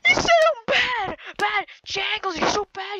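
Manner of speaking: high, loud whiny shout at a rival; mock angry yell. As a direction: yell it like a sore loser. Yelling at rival player.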